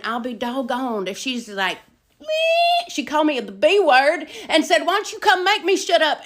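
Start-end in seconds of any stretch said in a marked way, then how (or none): 2.2-2.9 high, loud imitated squeal; mocking squeal. Mimics cellmate's noise, high squeal. 4.9-6.2 high, loud mock taunt; yelled challenge. Acts out cellmate's yelled challenge.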